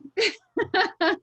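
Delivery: short, high, loud laugh; amused burst of laughter. Real, not acted. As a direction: burst out laughing at your own joke. Laughs at own driving remark.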